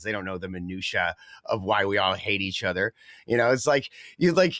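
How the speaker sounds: normal talking voice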